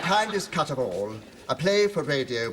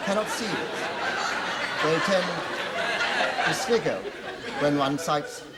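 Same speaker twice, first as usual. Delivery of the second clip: preaching. Acted. Vicar's slow sermon.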